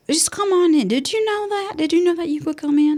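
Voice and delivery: playful impression of a soothing Southern doctor. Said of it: "she's got a really, like, soothing voice".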